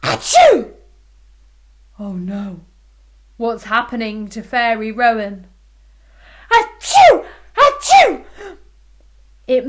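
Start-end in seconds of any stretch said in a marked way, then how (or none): 0.0-0.7 high, loud fake sneeze. Fairy sneezing behind the chair. 6.5-8.2 high, loud fake sneeze. Fairy sneezing behind the chair.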